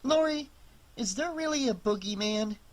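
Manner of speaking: put-on high, timid child asking; a small scared boy; little boy voice. Boy asks about boogeyman.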